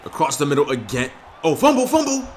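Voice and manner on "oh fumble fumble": high excited yelp of fumble; excited fumble call. Real, not acted. Gamer calls out a fumble in the game, high and loud. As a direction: shout it out as the ball comes loose.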